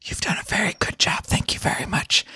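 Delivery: hushed, whispered thanks to little helpers; whispering. Acted. Player whispers to the threads left in their hand, whispering. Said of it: "I whisper to them".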